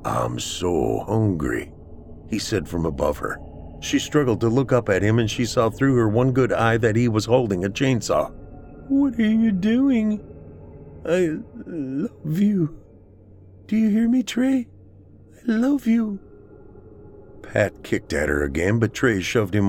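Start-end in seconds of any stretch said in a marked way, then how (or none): 0.1-1.7 very low, slow, drawn-out voice; possessed man. A narrator's reading. Possessed Trey standing above beaten Celeste, low and slow. 8.9-16.2 high, halting, drawn-out plea; tearful pleading. A narrator's reading. Celeste begging Trey as he holds a chainsaw, desperate.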